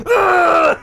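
loud high groan of rage; mock furious ugh; frustrated ugh. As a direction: let out the anger in one grunt. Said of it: "a way for me to be like".

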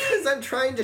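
high, loud, laughing; shouting. Real, not acted. Loud banter about yelling.

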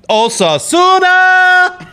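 Osasuna stretched out slowly, high, in a hammed-up Spanish accent; mock Spanish accent. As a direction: roll Osasuna out like a Spanish commentator. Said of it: "roll through my accents".

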